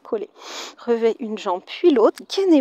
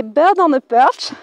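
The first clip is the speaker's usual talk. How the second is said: English words with a French accent; slow French-accented English, lifting at the end; 6 dB louder, slower than usual. Real, not acted. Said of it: "m'excuserez pour mon accent anglais".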